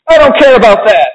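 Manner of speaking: put-on high, petulant child's outburst; Veruca shouting. A narrator's reading. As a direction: snap back like a spoiled girl. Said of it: "shouted Veruca".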